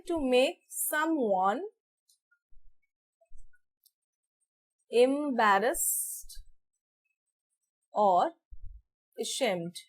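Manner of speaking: very slow, soft meaning dictated; quiet slow dictation. Shown as a demonstration. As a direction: let students write each word.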